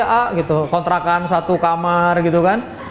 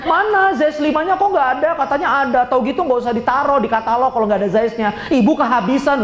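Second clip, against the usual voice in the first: higher, pushy complaint about sold-out stock; sharp-tongued mom; 6 semitones higher than usual. Retold or imitated. Mother scolding the seller over an empty catalog item, higher and sharp. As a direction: nag the seller like an irate shopper. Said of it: "ibu-ibu itu kenceng-kenceng tuh ngomongnya".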